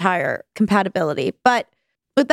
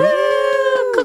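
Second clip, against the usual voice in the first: cheering. Real, not acted. Cheering to welcome guest.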